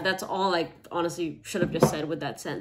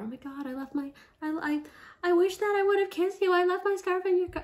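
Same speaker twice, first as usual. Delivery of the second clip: high, giddy lovestruck voice; smitten gush; 12 semitones higher than usual. Pretend. Acting out a smitten date.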